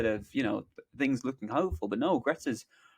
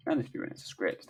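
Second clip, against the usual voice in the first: fast, softer muttered read-back; quick read-back; 3 dB quieter, much faster than usual. Real, not acted. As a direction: skim it under your breath. Quickly rereads the typed prompt.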